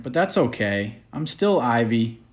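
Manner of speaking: plain reading voice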